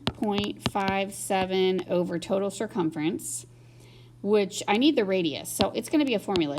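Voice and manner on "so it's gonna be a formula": normal talking voice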